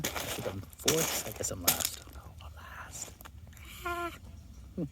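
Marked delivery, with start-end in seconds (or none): 2.0-3.1 whispering. Real, not acted. Quietly commenting during climbing exam.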